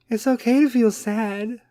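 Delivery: high, slow mock sympathy; Kim K. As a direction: coddle them in a dragged-out fake celebrity voice. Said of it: "is going to be all Kim K".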